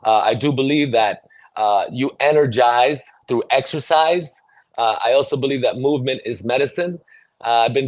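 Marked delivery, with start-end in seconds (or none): none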